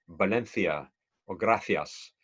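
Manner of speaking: "Valencia or gracias" with th sounds, valenthia, grathias; Castilian lisp. Shown as a demonstration. Teacher demoing the Castilian lisp, slowly. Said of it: "they would say".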